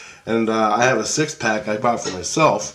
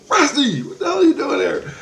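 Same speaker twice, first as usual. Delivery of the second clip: high, startled outburst at an unexpected friend; surprised outburst. Retold or imitated. To Rusty on the patio, a high startled outburst. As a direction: blurt it out, caught off guard.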